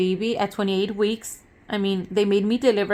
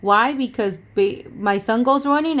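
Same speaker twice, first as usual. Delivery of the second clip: raised mock warning to running kids; mother retelling a firm warning to her kids; 3 semitones higher, 6 dB louder than usual. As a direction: call out the rule firmly, a bit louder. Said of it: "I tell them".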